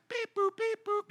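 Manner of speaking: high mechanical beeps; robot beeps. Pretend. God mocked as programming people, robot beeps. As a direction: beep like a toy robot.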